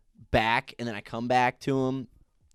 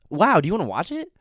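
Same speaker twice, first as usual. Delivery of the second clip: eager normal person; 6 semitones higher, 6 dB louder, faster than usual. Retold or imitated. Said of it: "like a normal person".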